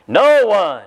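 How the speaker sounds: "No one" stretched out and punched; emphatic NO ONE. Shown as a demonstration. Preacher quoting the verse to brethren, emphatic "No one". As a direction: draw out "No" with force, falling on "one". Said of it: "That's an emphatic word".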